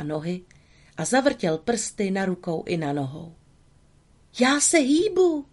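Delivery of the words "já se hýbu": put-on high, loud wooden-boy voice; puppet's amazed exclaim. Pinocchio, just alive, exclaims in amazed high voice. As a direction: cry out in wonder as a newborn puppet.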